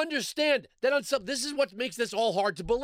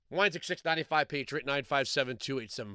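muttered code; 9 semitones lower, 5 dB quieter than usual. Acted. Ad read inserted.

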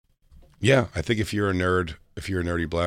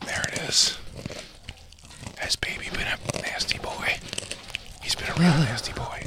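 whispering; 7 semitones higher, 6 dB quieter than usual. Pretend. Teasing whisper about chewing.

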